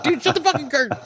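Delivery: retold protest breaking up in laughter; high-pitched mock yell through laughs; laughing mock outburst. Retells yelling at Josh while laughing.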